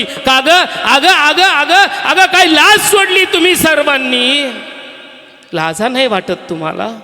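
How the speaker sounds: high, loud, outraged scold; scolding woman. Acted. Nursing mother scolding the other women, loud outrage. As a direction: tear into them for their shamelessness.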